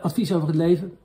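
ordinary talk

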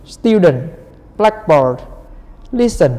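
"Student", "blackboard", "listen" each stressed up front, then falling; first-syllable stress. Shown as a demonstration. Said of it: "Còn lại là một". (nhấn âm đầu của "Student", "blackboard", "listen")

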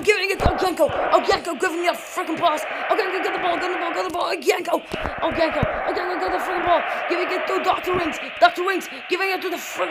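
breathless rapid-fire play-by-play; fast commentary. Real, not acted. Gamer calling the passes in his match, talking fast. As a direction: rattle off the passes without a breath. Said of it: "I'm talking so fast right now".

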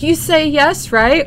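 high, loud imitation of a freaked-out crowd; retold excited screaming. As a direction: mimic excited strangers yelling a question. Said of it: "screaming and freaking out".

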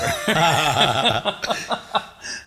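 high laughing burst; real laugh. Real, not acted. Laughing at his own bar story, real laugh. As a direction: let the laugh roll out.

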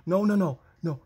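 high, loud panicked string of no's; real rising panic, rapid repeated protest. Real, not acted. Game goes disastrously wrong.

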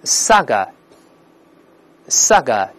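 saga ending in an open uh. Shown as a demonstration. Teacher has students repeat, saga with tongue uncurled. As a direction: say saga with no r at the end. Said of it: "没有这个字母R".

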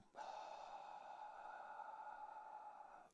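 slow, audible breath out; long exhaled sigh. Shown as a demonstration. Yoga teacher showing students the exhale and release, long soft sigh.

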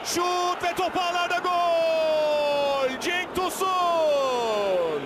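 long stretched goal scream; screaming football commentator. Real, not acted. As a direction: roar the goal and stretch the name. Goal call for Cenk Tosun.

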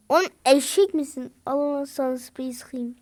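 usual speaking voice

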